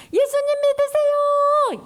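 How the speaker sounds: 높고 크게 느리게 외치기; 천천히 길게 불러 외쳐; calling out. Shown as a demonstration. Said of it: "이렇게 하는 거야". (높고 크고 느린 외침)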